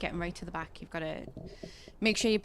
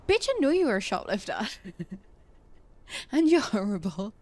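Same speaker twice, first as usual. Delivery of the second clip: mock outburst; 6 dB louder than usual. Mock-yelling at a snooty shopkeeper over a shoplifter. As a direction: snap it out like a fed-up customer. Mocking a rude shop customer.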